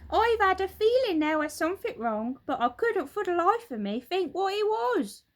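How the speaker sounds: put-on high, loud old woman's voice, baffled; Missus Twit, puzzled. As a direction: play a dim old woman who can't work it out, voiced high and loud.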